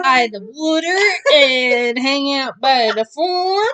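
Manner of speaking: slow, drawn-out mock Southern twang with a shout; exaggerated Southern drawl, slow and loud; hammed-up Southern accent. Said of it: "too many accents coming out".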